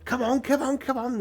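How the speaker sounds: high-pitched, urging clerk voice; eager hurrying clerk. Acted. Clerk leading visitors through the archive, eagerly hurrying. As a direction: chivvy them along in a high voice.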